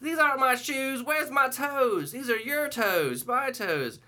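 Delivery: put-on high, loud, slow confused old woman; toenail lady. Old resident repeating, high loud confused whine. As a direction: whine it like a lost grandma. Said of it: "toenail lady is like".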